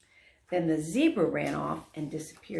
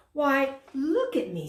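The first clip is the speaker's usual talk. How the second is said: high, delighted surprise; wide-eyed wonder at his own smoke; amazed; 8 semitones higher, 5 dB louder than usual. Acted. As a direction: marvel at yourself. Dragon marvels at his smoke.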